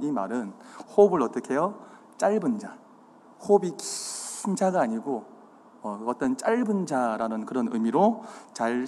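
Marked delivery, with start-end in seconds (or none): 3.8-4.8 긴 stretched out long in 긴 자가; drawn-out emphasis. Shown as a demonstration. Preacher contrasting breath lengths, 긴 stretched out. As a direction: draw out 긴, higher.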